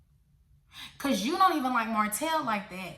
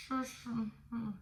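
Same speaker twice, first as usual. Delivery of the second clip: mock Trish mumble; 8 dB quieter than usual. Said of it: "she talk like this".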